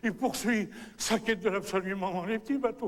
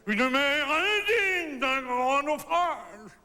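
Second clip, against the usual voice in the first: high, drawn-out theatrical cry; grandiose denunciation; 9 semitones higher, 4 dB louder, much slower than usual. Acted. Storyteller scorning the imaginary sea, high slow declamation. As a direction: declaim it slowly in mock outrage. Mounting mock indignation at the sea.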